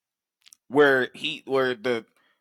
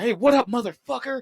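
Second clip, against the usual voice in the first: put-on taunting kid voice; 10 semitones higher than usual. Kids taunting the villain.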